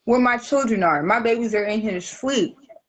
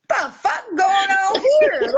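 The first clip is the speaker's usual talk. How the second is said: outraged cry; 10 semitones higher, 3 dB louder, slower than usual. Retold or imitated. Finding him doing lines at home.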